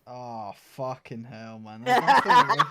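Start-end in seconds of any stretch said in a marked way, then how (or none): 1.8-2.7 breaking into a high laugh mid-word. Real, not acted. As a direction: laugh through the words in disgust. Disgusted at the rotten egg flavour.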